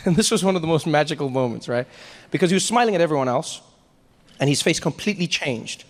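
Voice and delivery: usual speaking voice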